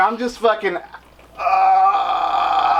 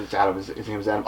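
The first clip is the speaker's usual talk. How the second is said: softer, lower, leaning-in correction; quiet aside; 9 semitones lower, 8 dB quieter than usual. Retold or imitated. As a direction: murmur it close to ear. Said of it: "Jamie leans in and goes".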